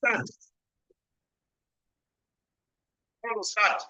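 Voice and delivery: bad connection, voice cutting out; choppy network audio; radio breaking up. Real, not acted. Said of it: "Prabhu Kavi is breaking up his voice".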